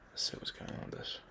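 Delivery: whispered aside. Real, not acted. Planning his day aloud, he whispers to himself. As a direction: whisper it to yourself. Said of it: "whispered aside while planning".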